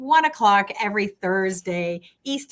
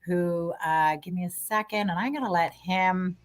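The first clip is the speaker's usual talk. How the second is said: ordinary talk, nothing marked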